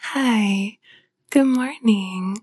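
drowsy just-woke-up whisper; sleepy voice. Real, not acted. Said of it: "I've kinda got my sleepy voice going".

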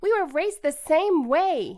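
high, loud exasperated shout; angry shout. Acted. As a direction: yell it at your twin. Sibling quarrel.

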